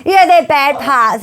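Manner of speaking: loud, high, slow laughing agreement. Real, not acted. Mum agreeing about kids' bad parts, laughing. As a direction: laugh through it, drawn out.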